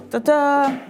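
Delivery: ordinary talk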